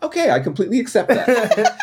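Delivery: high, laughing mock acceptance; mock agreement. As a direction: chirp it out with a laugh. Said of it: "they're just like".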